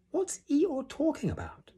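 very high, small piggy voice; whispering Piglet. A narrator's reading. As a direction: squeak a furtive question to a friend. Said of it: "Piglet whispered to Pooh".

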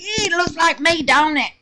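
laughing. Real, not acted. Comparing herself to the wrinkled soap, laughing. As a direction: joke at herself while still laughing. Jokes soap looks like her.

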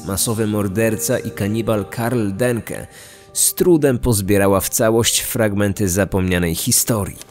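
ordinary delivery

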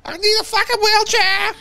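high, loud hammed-up wail; screaming in mock agony. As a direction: pretend maimed intruder yelling in pain. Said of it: "somebody's screaming".

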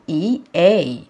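"اي اي" spelled out letter by letter; name each letter of "اي اي" distinctly. Shown as a demonstration. Teacher spelling the two letters for pupils, letter by letter. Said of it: "ايه ال two letters بتوعنا؟".

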